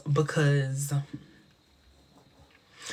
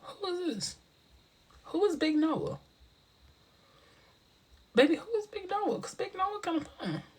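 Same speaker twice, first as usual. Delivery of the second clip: flirty; 13 semitones higher, 6 dB quieter than usual. Real, not acted. Flirting over a viewer named Noah.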